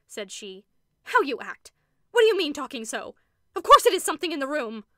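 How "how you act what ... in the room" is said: loud, furious rebuke; fury. A narrator's reading. Carolyn turns on Rebecca, loud furious rebuke. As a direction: lash out at her sister. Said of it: "turned upon her with a sort of fury".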